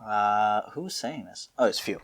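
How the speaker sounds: usual speaking voice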